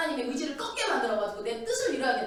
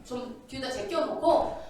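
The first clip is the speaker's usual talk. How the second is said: ordinary talk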